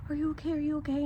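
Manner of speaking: gasp, then high fussing questions; mock alarmed concern. Bystander fusses over someone using an inhaler, mock alarm.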